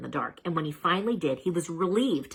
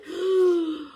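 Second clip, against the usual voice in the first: hammed-up high gasp; mock gasp; 12 semitones higher than usual. A narrator's reading. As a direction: gasp in fake horror. Narrator gasps in mock shock.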